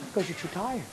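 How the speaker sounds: very soft, tempting little voice; coaxing inner voice. Acted. Little voice talking a tired student into sleep, coaxing. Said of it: "The voice says".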